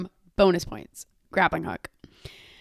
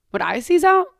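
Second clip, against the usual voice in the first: mock alarm; 9 semitones higher, 4 dB louder than usual. Mock alarm self-question.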